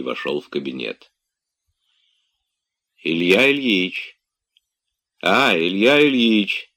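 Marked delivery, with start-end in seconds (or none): none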